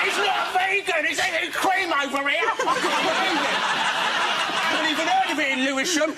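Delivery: high, indignant impression; imitating Scott. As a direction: squeak out the accusation in mock outrage. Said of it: "And Scott's going".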